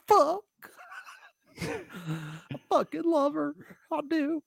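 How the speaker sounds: high, breathless mock devotion; squeaky hammed-up lovesick groom; parodying the groom saying his vows, high and squeaky. Mocking groom at altar.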